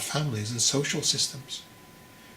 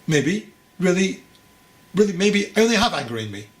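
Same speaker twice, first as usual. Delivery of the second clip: defiant retort; 5 semitones higher, 5 dB louder than usual. Acted. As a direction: throw it back at him, half challenging. Young man's defiant retort.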